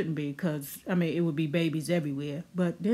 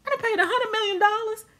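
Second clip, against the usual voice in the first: much higher squeaky diva voice, laughing through it; a rich woman fed up, pitched way up; snapping diva; 13 semitones higher, 3 dB louder than usual. Retold or imitated. Coco fed up with vitamin cubes, high squealing diva rant. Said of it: "she snaps and she's like".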